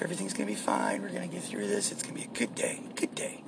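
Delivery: very soft, nearly voiceless pep talk; calm yourself under your breath; hushed self-reassurance. Pretend. Pep talk to himself before work.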